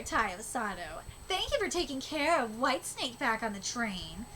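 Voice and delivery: ordinary delivery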